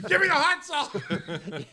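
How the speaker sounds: high, slow, drawn-out yell; big, loud, hammed-up shout; shouted catchphrase. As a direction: holler it as a comic catchphrase. Performs his signature catchphrase.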